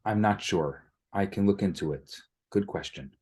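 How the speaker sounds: ordinary talk, nothing marked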